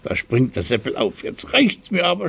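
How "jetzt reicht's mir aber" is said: high protesting cry; indignant shout. Acted. Seppel protesting the beating, high indignant cry. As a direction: yelp that enough is enough. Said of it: "schreit er".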